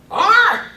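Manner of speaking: loud, high parrot squawk; high-pitched parrot screech. Acted. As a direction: squawk suddenly like a startled parrot. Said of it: "The parrot goes".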